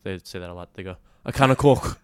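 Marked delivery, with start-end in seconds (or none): 1.2-2.0 a Scouse "can of Coke" with an open "can"; Liverpool accent. Shown as a demonstration. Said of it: "I'll do Liverpool now".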